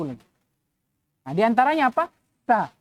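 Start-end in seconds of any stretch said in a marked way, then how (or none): none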